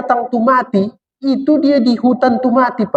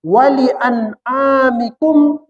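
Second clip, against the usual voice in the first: slow, drawn-out Arabic recitation; Quran recitation; 4 semitones higher, much slower than usual. Shown as a demonstration. Preacher reciting the verse to the congregation, slowly. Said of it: "untuk siapa lagi itu kuciptakan".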